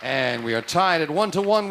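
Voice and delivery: excited game show reveal; game show host. Acted. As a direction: announce the result with flair. Host announces tie.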